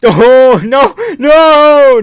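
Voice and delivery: high, loud half-laughing cries of no; panicked laughing yell. Real, not acted. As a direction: disbelief turning to nervous laughter. Fighter still losing live.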